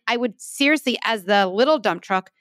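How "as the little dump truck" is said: ordinary talk, nothing marked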